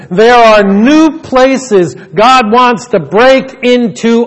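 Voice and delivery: loud, high, impassioned sermon delivery; full-force preaching. Real, not acted. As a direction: drive the point home to the whole congregation.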